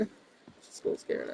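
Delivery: whispered, mocking fear; hammed-up frightened whisper; mock scared whisper. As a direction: breathe it like a cowering heckler.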